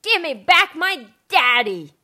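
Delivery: put-on girl yelling down the hole for her father. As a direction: call down into the ice hole. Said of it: "She yelled down the hole".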